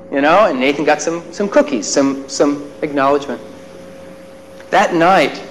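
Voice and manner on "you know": normal talking voice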